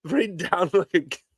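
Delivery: slow, laughing through the words; giggling repeat. Real, not acted. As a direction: crack up while repeating it.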